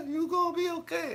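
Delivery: high mock-tearful coworker; crying lady. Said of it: "I'm talking about".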